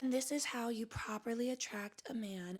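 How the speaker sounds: hushed, soft spiritual-coach voice; mock soft-spoken guru. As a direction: imitate a gentle advice video, very softly. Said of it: "I'm not going to be the one that's like".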